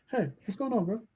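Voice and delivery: lightly imitated New Zealand bouncer, high and soft; soft, high-voiced New Zealand doorman. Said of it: "just like a New Zealand bouncer".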